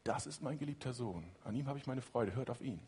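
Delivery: hushed divine voice, lightly voiced; solemn voice from heaven. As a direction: let the heavenly voice fall quiet and grave. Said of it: "Und wieder diese Stimme".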